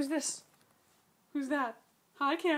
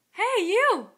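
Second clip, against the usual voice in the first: calling out; 8 semitones higher, 8 dB louder than usual. Real, not acted. Calling the toddler to attention.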